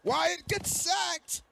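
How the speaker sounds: high, excited sports commentator call; play-by-play. Acted. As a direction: call the final play like live TV. Hyped play-by-play of final play.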